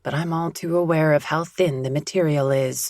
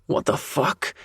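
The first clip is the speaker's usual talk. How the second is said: gruff man voice. Acted. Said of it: "like sandpaper against rocks".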